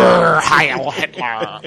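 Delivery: slow, growled hammed-up pirate; pirate accent. Co-host showing how the star should have said it, pirate accent. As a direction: roar it out slowly like a pirate. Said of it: "should have gone with a pirate ... accent".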